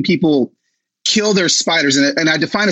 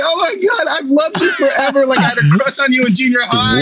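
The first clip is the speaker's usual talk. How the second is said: high, tearful plea, mock heartbroken; retold crying; 6 semitones higher than usual. Telling a TV host he loved her, near tears. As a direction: whimper it like a starstruck fan near tears. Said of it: "I was started crying".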